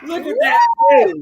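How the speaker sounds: very high, gushing squeal; delighted high-pitched fawning; adoring squeal. Real, not acted. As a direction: squeal it like cooing at someone adorable. Gushing over friend just arrived.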